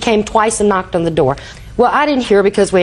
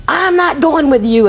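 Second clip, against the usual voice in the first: mild mock yell, pitched higher; a granddaughter defying her mother, higher mock yell; retold scream; 6 semitones higher than usual. Granddaughter refusing to leave with her mother, higher mild mock yell. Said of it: "screamed at her mother".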